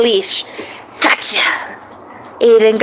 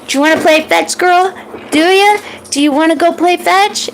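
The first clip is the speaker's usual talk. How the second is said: put-on higher, eager kid voice; excited; 6 semitones higher than usual. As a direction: ask like a thrilled child. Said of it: "Aiden said excitedly".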